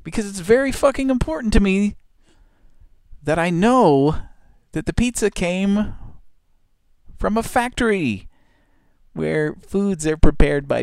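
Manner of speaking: very high mocking squeal; mock guest. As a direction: gush sarcastically, very high. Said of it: "everybody's like".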